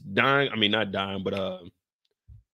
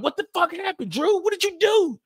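high mock outrage, much higher; fake shock. Tariq faking outrage at Drew over the bodies. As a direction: ham up the shocked accusation. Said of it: "you can get there like".

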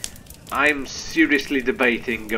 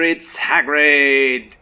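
excited; 3 semitones higher, 8 dB louder, much slower than usual. Real, not acted. Greeting a beloved character.

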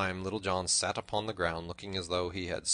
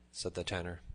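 ordinary delivery, nothing marked

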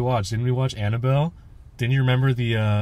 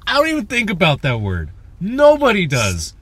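mock angry; 5 semitones higher, 4 dB louder than usual. Man angry at people banning the word, mock outrage. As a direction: scold them higher and higher, hammed-up. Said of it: "me being angry at them".

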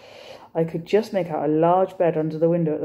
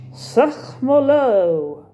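French; 7 semitones higher, 5 dB louder, much slower than usual. A narrator's reading. French poster read aloud.

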